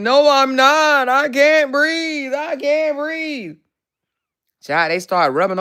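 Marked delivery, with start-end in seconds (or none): 0.0-3.6 high, slow, desperate mock panic; retold panic. To ER staff, insisting she can't breathe, hammed-up panic. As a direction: plead that you can't breathe, dragging each word.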